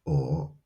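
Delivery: "o" held low and soft; third tone. Shown as a demonstration. Teacher modelling tones for repetition, "o" low. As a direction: drop "o" to the bottom of the voice. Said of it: "it is a low".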